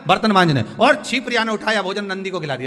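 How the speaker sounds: loud, high, lively retelling; animated sermon storytelling. Acted. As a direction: carry the crowd through the mischief. Acts out a sacred tale.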